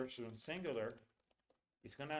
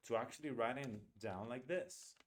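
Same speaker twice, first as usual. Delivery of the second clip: ordinary delivery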